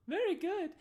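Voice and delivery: very high, loud, squeaky hostess voice; squeaky, loud Darnsky serving the party; eager barmaid. Acted. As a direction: push the drink on them with bubbly certainty. Darnsky answers.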